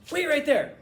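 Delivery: high, loud, excited command; Andy. Retold or imitated. Friend telling the custodian to stay put, really excited. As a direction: call it out in a sudden burst of excitement. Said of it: "when he got really excited, he'd go".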